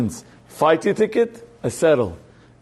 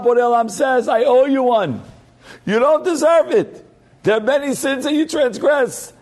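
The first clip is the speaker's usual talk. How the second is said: much higher, slow, booming proclamation; voice of God; 9 semitones higher than usual. Retold or imitated. God telling the undeserving person he is owed one, high and slow. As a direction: lift the voice up as if heaven is answering. Voicing God's generous reply.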